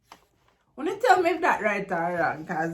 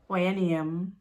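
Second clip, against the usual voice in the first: Jamaican Patois; 3 semitones lower than usual. Shown as a demonstration. Teacher translating for learners, in Jamaican Patois. Patois version of English model.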